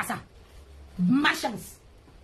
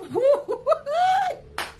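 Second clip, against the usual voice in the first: shrieking laugh; 12 semitones higher than usual. Real, not acted. Mocking rival on a live stream.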